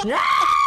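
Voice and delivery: short laughing yelp; a playful shriek; mock scream. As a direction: yelp as if ripping the head off. Mock scream ripping doll's head.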